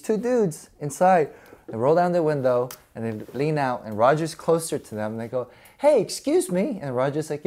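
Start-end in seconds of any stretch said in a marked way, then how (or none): 5.8-6.8 a much higher, put-on "hey, excuse me"; mock polite call-out. A guy leaning out of a truck calling to Roger, higher put-on voice. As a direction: sing it out sweetly before the insult.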